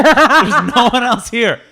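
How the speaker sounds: loud, slow, laughing protest; ranting at the boss through laughter; laughing mock rant.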